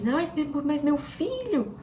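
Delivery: high, slow, irritated "meu filho"; irate mother. Retold or imitated. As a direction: scold the son, dressed up as love. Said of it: "como se fosse algo em amor, mas irada". (mãe irritada falando com o filho)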